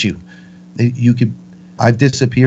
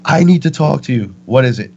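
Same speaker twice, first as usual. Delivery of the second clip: higher-pitched, mild mock yell; retold screaming; 4 semitones higher than usual. Participant confronting Mother at ayahuasca, higher mock yell. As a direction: demand answers in a half-voiced scream. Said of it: "screaming at her".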